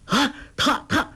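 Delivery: high, stammering cry of alarm; panicked stammer, pitched high; shocked cry. Acted. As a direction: blurt it out in sudden shock. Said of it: "叫道".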